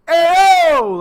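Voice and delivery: loud, high-pitched call-out; yell. Retold or imitated. Imitating Lester's yell before he decks him, loud holler. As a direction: holler it sharply to turn someone's head. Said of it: "he yells like".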